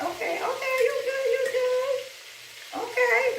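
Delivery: high, soothing coo at the pan; coaxing the chicken. Real, not acted. Cook cooing to frying chicken, high and soothing. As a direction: sweet-talk the chicken in a high voice.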